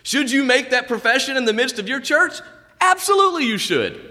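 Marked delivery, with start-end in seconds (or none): 2.8-4.0 emphatic. Real, not acted. Preacher answering his own question with a fervent, high-pitched yes. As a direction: absolutely hit much higher, swelling with conviction.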